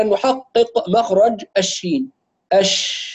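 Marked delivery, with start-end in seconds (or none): none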